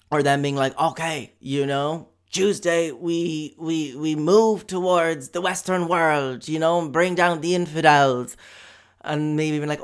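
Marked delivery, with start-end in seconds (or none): 2.3-8.4 slow, lightly voiced solemn extremist; mock extremist plan. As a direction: intone the plot slowly as a mock jihadist.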